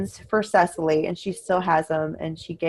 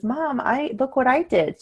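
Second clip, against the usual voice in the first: put-on child voice; 7 semitones higher than usual. Child voice in retold story.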